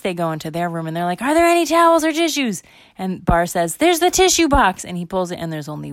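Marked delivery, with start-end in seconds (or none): 1.2-2.6 high, loud urgent question; acted high-pitched shout; frantic kids. 3.8-4.9 high, loud call; acted high-pitched exclamation; urgent kid discovery. Bar spots tissues, calls out.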